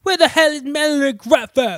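cheeky English rock-star accent, played for laughs. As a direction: bark it like a hammed-up English rocker. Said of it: "He's literally doing, like, [name]".

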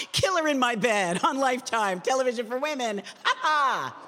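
high promo read laughing through it; forced fun, giggle in the read; having fun with it. Acted. Voice actor obeying have fun with it, laughing promo read. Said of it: "Have fun with it".

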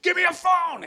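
put-on screamed, high and loud, drunk and frantic; drunk panicked shout. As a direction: yell it out like a drunk in shock. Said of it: "he's out of his mind".

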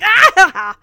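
loud imitated "ah" breaking into a laugh; mock yelp of pain. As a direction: yelp as if pricked by a pin. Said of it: "and he's like".

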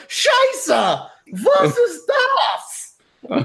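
very high shouted German outburst; shocked German cry. Acted. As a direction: yelp it like a punched German dandy. GM voices Duke knocked down.

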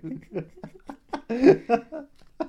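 high burst of laughter; loud laugh. Real, not acted. Laughing at joke.

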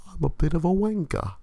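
put-on high, awkward British accent; mock British accent; nerdy British accent. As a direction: play a self-deprecating British dork. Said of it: "a voice".

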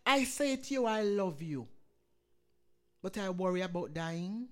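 rising, loud sermon delivery with surges; full-force preaching. Acted. Pastor exhorting the congregation in wartime fear, preaching at full force.

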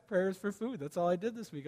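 soft, sheepish admission; quiet admission. Shown as a demonstration. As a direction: mumble it like a confession. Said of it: "on a bad week".

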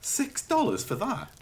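high-pitched, slow disbelief; incredulous. Real, not acted. Customer balking at a six-dollar ball, incredulous. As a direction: balk at the price.

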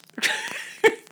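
high breathy burst of laughter. Real, not acted. Laughing at the ring guy's remark.

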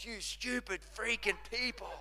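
high, softened comic scolding; mock exasperation. Pastors ribbed as the stupid people, mock exasperated. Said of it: "Pastor Sam and Carolina sometimes".

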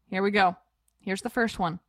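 ordinary talk

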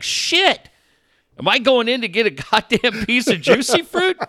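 high, loud startled inner voice, laughing; hammed-up alarm at his own situation; mock panic. Recalling his panic in the clinic, high laughing mock alarm.

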